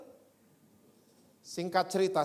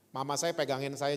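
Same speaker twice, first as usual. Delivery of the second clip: ordinary delivery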